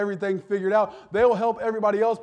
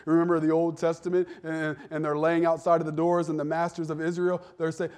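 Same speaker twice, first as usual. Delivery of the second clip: ordinary delivery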